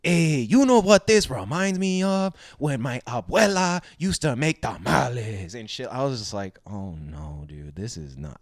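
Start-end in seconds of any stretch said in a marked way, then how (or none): 0.0-5.9 exaggerated, sing-song Mexican accent. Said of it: "he were talking like this". 6.7-8.4 low, soft retold dismay; understated dismay. Recalling his reaction to the skeletons, low quiet dismay.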